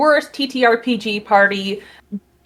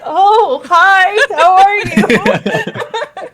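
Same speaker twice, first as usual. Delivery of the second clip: flustered greeting; 10 semitones higher, 4 dB louder, much slower than usual. Retold or imitated. Flustered reply, caught off guard.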